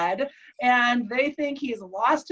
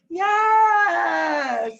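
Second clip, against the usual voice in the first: loud, higher, long-held exclamation; retold emphatic yes; 5 semitones higher, 5 dB louder than usual. Wife answers patient who realizes he survived, exultant. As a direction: act out an exultant confirmation.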